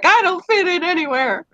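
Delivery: mock despair. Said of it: "I'm like".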